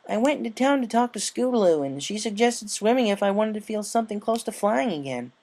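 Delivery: high, bright tomboy pony voice; upbeat sporty pony, carefree; Rainbow Dash voice. A narrator's reading.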